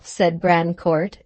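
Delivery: ordinary delivery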